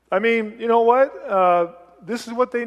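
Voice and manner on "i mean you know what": ordinary delivery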